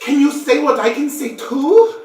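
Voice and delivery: put-on high, loud, gushing child voice; excited. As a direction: squeal it with glee. GM voices excited dark elf child.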